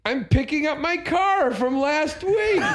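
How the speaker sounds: high, slow, drawn-out impression; Andy Dick. Andy explaining his visit to Jim, high drawling impression. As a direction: stretch it out in a comic high voice. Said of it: "Not, not a bad impression".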